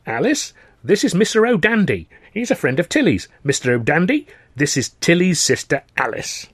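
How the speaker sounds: a hint of a chirpy host; father introducing a guest. Father introduces visitor to daughter.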